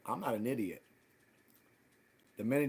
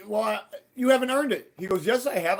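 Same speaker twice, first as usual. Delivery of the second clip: heated argument; 8 semitones higher, 6 dB louder than usual. Retold or imitated. Retold back-and-forth argument.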